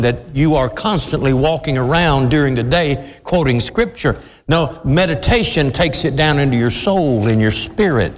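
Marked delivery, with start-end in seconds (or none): none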